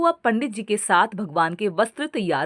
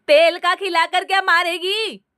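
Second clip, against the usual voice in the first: high, louder scolding mother-in-law; 9 semitones higher, 4 dB louder than usual. A narrator's reading. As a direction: snap at the oily snacks. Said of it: "सास ने कहा".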